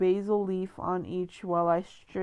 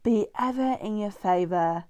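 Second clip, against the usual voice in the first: lightly hammed-up British Capitol accent; mock British accent. Gale finishing Effie's reaping toast, mock British accent. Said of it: "for that British accent".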